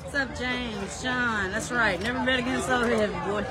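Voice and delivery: ordinary talk, nothing marked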